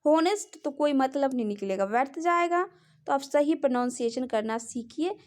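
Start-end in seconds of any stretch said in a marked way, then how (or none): 0.0-0.6 होनेस्ट starting on an audible H; wrong sounded H. Shown as a demonstration. Teacher warning that nobody understands honest with sounded H. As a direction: sound the H in होनेस्ट, 'ho-nest'. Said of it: "अगर किसी को बोलेंगे भी".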